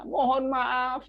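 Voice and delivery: high, slow, drawn-out plea; sorrowful apology. Shown as a demonstration. Teacher translating the example of sadness, acted sorrowful apology. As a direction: act out being very sorry. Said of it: "eh, sedih ya".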